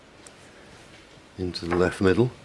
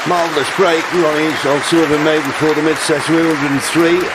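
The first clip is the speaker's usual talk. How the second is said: loud, high formal announcement; announcer voice; 9 semitones higher, 8 dB louder than usual. Acted. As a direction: announce it to the whole arena.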